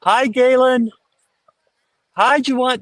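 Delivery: high, bright polite hello; mock the nice student crowd; sweet friendly greeting. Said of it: "they all scream at the player".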